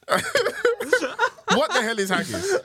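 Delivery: high, helpless laughter through the words; real laughing fit breaking up the question. Real, not acted. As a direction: crack up while asking in disbelief. Cracking up over haggis.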